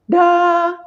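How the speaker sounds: "da" sung in a high head voice; head voice demo. Shown as a demonstration. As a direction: lift "da" up into the head voice. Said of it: "itu adalah suara dua".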